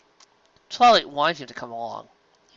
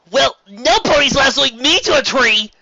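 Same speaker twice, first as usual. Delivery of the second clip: put-on higher, louder indignant kid voice; louder, raised indignant protest; indignant Spike; 12 semitones higher, 9 dB louder than usual. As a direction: refuse it loudly like a young dragon.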